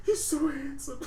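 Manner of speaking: high, hammed-up weepy line; mock crying. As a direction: whimper it through fake tears. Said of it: "he's sitting there crying".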